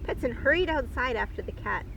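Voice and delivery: usual speaking voice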